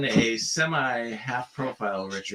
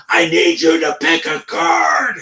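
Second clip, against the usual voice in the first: shouting; 6 semitones higher, 10 dB louder, slower than usual. Retold or imitated. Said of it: "I was going".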